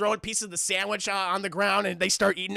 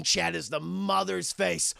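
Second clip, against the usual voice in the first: usual speaking voice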